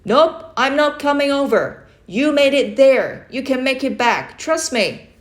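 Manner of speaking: loud, high firm refusal called over; firm calling. Acted. Uncle refuses to come over, firm loud call. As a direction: refuse kindly but firmly. Uncle calls across the river.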